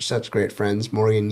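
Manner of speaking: normal talking voice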